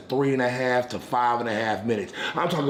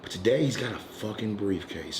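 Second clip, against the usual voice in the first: ordinary talk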